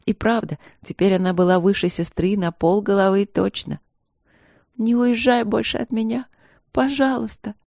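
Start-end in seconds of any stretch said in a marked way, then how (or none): 4.8-7.5 pleading. A narrator's reading. Crying child begging sister stay.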